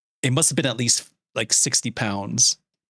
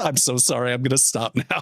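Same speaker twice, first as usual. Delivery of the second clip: normal talking voice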